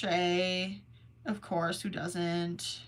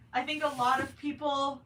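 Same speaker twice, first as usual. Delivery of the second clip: ordinary talk, nothing marked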